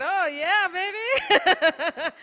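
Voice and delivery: high, loud mock come-on; mock flirting. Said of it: "they were trying to flirt with us".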